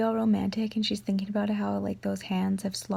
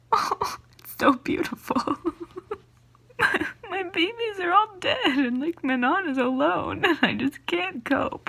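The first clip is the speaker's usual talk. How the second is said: high tearful wail; crying, a mess; 7 semitones higher than usual. Real, not acted. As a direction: sob out your grief for beloved characters. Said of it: "look at me, I'm a mess now".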